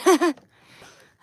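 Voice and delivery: big loud burst of laughter; a loud, delighted laugh. Real, not acted. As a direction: laugh out loud at the crack of your back. Laughing after the back crack.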